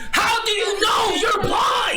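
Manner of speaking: mock argument shout. Streamer acts out the blind men's quarrel, mock shouting. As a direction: yell it like a fed-up blind man.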